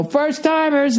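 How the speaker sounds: high-pitched mock call-out; imitated kitchen yell. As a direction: call it out high, like staff announcing newcomers. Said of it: "everybody in the kitchen yelled".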